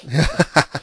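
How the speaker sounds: a loud, open burst of laughter; hearty laugh. Real, not acted. Guest laughing at his own book title, loud real laugh.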